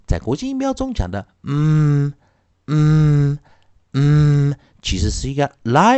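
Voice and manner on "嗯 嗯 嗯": 嗯 as a hummed "mmm"; word-ending m, held with no vowel; light final M. Shown as a demonstration. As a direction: let 嗯 ring on closed lips. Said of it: "也就是比较轻声的M".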